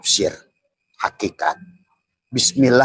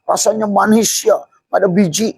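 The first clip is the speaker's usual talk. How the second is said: high, loud, gushing about sweet grapes; carefree delight; 14 semitones higher, 5 dB louder than usual. Acted. Careless man gushes over seedless grapes, loud and high. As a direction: savour it, oblivious and happy. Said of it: "dia malah asik".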